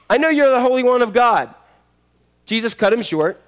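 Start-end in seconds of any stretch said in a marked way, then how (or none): 0.1-1.6 raised, higher demonic cry; acted cry of accusation; possessed man shouting. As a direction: call out as the evil spirit. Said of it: "evil spirit began shouting".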